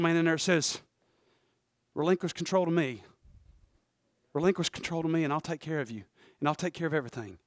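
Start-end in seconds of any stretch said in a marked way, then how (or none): 1.9-7.4 very soft, lower inner voice; soft-spoken. Acted. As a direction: soothe like a gentle spirit. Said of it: "so soft and so soft-spoken".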